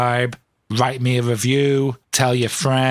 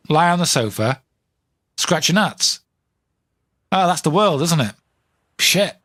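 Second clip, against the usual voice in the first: normal talking voice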